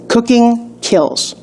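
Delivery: "Cooking kills" said very slowly, each word weighted; slow motto. Acted.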